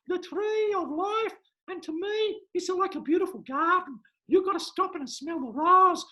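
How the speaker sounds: hammed-up psychic reading a child's tea leaves; Madame Markovsky. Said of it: "Madame Markovsky".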